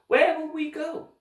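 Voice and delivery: high, slow, worried question; nervous. Acted. Orphan girl asking Mister Poe, nervous and high. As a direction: ask it small and uneasy. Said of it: "Violet asked nervously".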